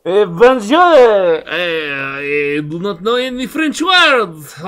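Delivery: drawn-out mock French accent; French accent slipping toward Russian; hammed-up French accent. Shown as a demonstration. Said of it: "You wanna hear me do French?".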